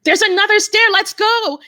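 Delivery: high, loud angry shout; frustrated survivor urging the group to go; angry call. Retold or imitated. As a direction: shout at them to follow. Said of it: "I was angry and I'm like".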